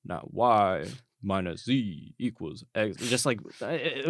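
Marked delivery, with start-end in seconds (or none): 0.0-3.0 slow, flat, droning teacher; monotone teacher voice. Retold or imitated. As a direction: drone it out like a dull math professor. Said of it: "talk in, like, a very monotone voice".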